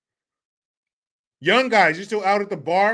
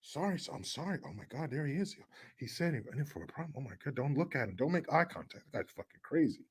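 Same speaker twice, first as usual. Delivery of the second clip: low, very soft, hurried murmur; frightened hush; 6 semitones lower, 16 dB quieter than usual. Acted. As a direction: play a cowed crowd whispering among themselves. Frightened crowd parting before him.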